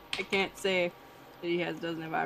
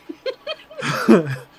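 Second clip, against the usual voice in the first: loud burst of laughter; 15 dB louder than usual. Real, not acted.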